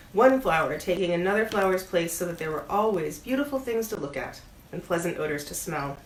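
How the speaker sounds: usual speaking voice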